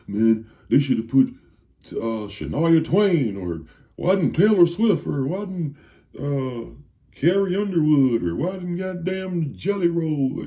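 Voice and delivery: high-pitched mock grumbling with drawn-out names; mock complaint, voice climbing on each name; mimicking complainers. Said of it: "another people saying".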